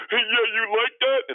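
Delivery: very high, loud taunt; hammed-up squeaky boast; mock trash talk. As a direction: crow it like a hopeless trash talker. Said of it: "the trash talking is not working".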